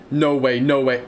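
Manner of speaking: ordinary talk, nothing marked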